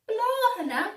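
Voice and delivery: high, loud surprised cat; the cat amazed at flowers. A narrator's reading. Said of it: "ar sa Cligging".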